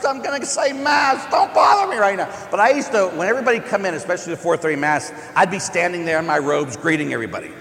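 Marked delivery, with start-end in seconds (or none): 0.0-2.3 exasperated shout. Retold or imitated. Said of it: "'Cause they love to complain to Father".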